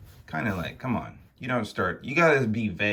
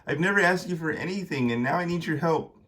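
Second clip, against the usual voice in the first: high, hurried plea; pleading son; 7 semitones higher, faster than usual. Acted. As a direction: beg a parent for help, quickly. Konstantin pleads with his dad.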